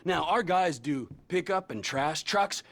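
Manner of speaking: ordinary talk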